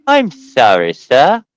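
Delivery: put-on polite English accent; hammed-up English apology, lifting at the end. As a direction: play the apologetic English motorist. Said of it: "start talking with your English accent".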